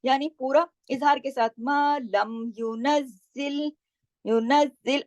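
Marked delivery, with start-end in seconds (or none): 1.6-3.7 slow recitation with the doubled z held; Quran की तिलावत. Shown as a demonstration. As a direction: recite मा लम युनज़्ज़िल, ज़ाई सुनाई दे दो बार. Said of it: "ज़ाई पे तशदीद की वजह से हमने नज़ और ज़िल दो दफा ज़ाई को पढ़ा". (ज़ाई पर तशदीद के साथ धीमी तिलावत)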